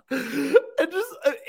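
usual speaking voice

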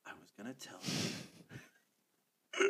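very slow, low imitated whisper; retold stage whisper. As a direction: imitate a child whispering just loud enough. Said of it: "whisper loud enough for both me".

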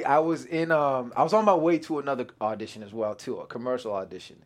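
normal talking voice

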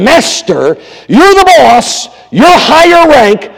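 high, drawn-out grudging deference; with attitude. Acted. Peter unwillingly obeying Jesus, with pointed attitude. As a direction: pull rank on him with exaggerated, stretched words. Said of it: "could be a little bit of attitude in".

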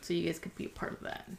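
soft, unvoiced fast whisper; whispered repeat. Real, not acted. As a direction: whisper it quickly to yourself. Repeats line softly.

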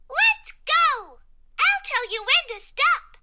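very high, loud, commanding character voice; Period. A narrator's reading. Period bringing the line to a close, high bossy voice. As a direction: take charge in a high cartoon voice. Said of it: "Period followed".

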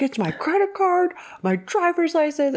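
high-pitched mock protest; exasperated high-voiced imitation; pleading protest. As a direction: act out a man protesting, voice pushed way up. Acting out Bill's indignant protest.

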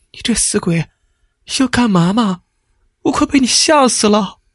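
a wife scolding her husband, shaken and relieved; agitated wife. A narrator's reading. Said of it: "显得有些激动".

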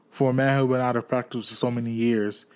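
ordinary delivery

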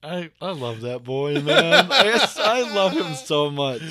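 laughing. Real, not acted. Laughing love for son.

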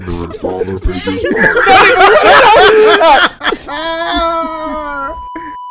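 howling with laughter; cracking up hard. Real, not acted. Bursting out laughing.

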